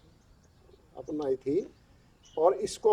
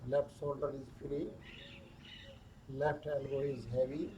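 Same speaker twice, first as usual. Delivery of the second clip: very slow, hushed body-scan voice with long pauses; guided meditation; 2 semitones lower, 8 dB quieter, much slower than usual. Acted. Yoga teacher guiding stress down the arms, slow guided meditation.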